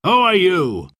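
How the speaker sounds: high, demanding question; cried-out challenge. A narrator's reading. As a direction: challenge the stranger with a cry. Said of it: "cried the sheriff".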